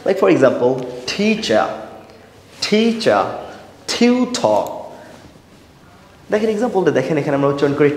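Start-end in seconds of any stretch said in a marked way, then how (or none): 1.1-4.6 "teacher", "tutor" higher, with a puff on the T; aspirated T. Shown as a demonstration. Teacher models native-like speech, "teacher" and "tutor" with aspirated T. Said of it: "ঠ এর মতো উচ্চ করে উচ্চারণ করবো".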